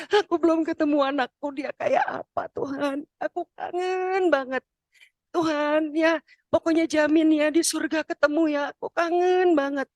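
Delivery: acted high-pitched yearning; longing prayer. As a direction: plead to God as an aching mother. Longing mother praying for lost son.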